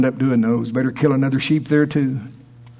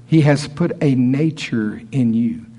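normal talking voice

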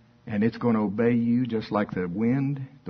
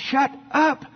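high-pitched, fussy shushing; hammed-up religious scolds, pitched high; scolding crowd; 12 semitones higher than usual. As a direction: shush a noisy beggar indignantly. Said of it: "And they all going".

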